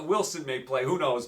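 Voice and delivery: usual speaking voice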